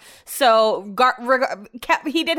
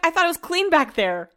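mock sarcastic question; 8 semitones higher than usual. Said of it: "And I'm like".

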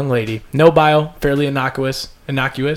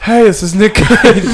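slow, high, emotional Nick Cage greeting; more emotion; 7 semitones higher, 6 dB louder, slower than usual. Retold or imitated. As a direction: overact the greeting with feeling. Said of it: "more emotion".

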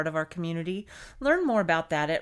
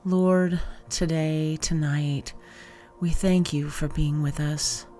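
even, low-key and deliberate, phrase by phrase; guided meditation; 4 semitones lower, 5 dB louder than usual. Acted. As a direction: guide listeners gently through a breath prayer and body scan, unhurried. Leads body scan meditation.

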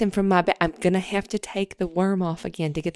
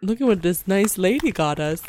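worm puppet voice. Acted. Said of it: "it's a hand puppet".

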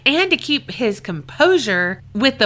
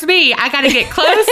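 higher, louder, laughing through it; giggling confession; 6 semitones higher, 4 dB louder than usual. Real, not acted. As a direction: chuckle while owning the mistake.

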